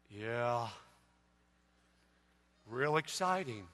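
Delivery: very low, soft and unexcited; mock-glum murmur with no enthusiasm; deadpan Eeyore-like mom. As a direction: imitate an underwhelmed, gloomy mom. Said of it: "kinda like an Eeyore moment".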